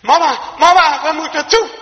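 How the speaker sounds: put-on high, loud panicked child's call; frightened cry. Lost boy in the snow calling for his mother, frightened. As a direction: cry out for mama in fear. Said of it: "dan wordt hij angstig".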